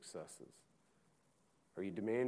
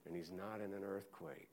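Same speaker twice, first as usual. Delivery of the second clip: quiet letdown; 3 semitones lower, 8 dB quieter than usual. Acted. Preacher drops voice after climax.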